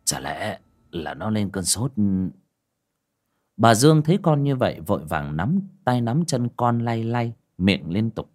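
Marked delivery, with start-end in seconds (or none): 0.0-1.9 put-on low, soft, hesitant old man; father checking whether his son still breathes; worried old father. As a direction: murmur unsure, listening for breath.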